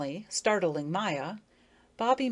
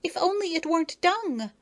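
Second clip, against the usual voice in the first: higher, aloof and dismissive; offish; 11 semitones higher, 4 dB louder than usual. A narrator's reading. Effie to Bobby, offishly and a touch higher. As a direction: turn your nose up at him. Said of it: "said Effie offishly".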